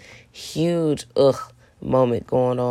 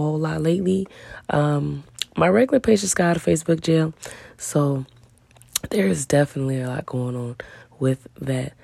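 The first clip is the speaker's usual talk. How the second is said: ordinary talk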